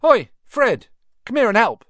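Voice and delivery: high loud call down the train. A narrator's reading. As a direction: shout across for your brother. Twin calls brother over.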